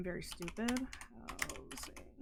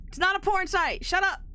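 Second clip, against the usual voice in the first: high, loud protest at chat; defensive yelp; indignant retort; 10 semitones higher, 8 dB louder than usual. Real, not acted. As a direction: shout it back, much higher and louder. Snapping back at chat teasing.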